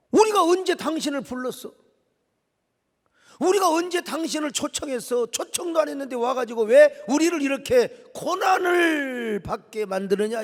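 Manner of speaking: loud, high-pitched indignant protest; accusing elders. Acted. Israelite elders turning on Moses, indignant. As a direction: throw angry questions at Moses. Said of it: "지도자들이 뭐라고 말한 줄 아세요".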